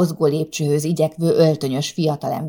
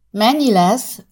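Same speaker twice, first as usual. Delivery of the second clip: urging; 6 semitones higher, 5 dB louder, slower than usual. A narrator's reading. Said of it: "kérdezte aztán sürgetőleg".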